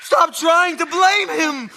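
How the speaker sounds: angry shout. Acted. Son shouts at mother.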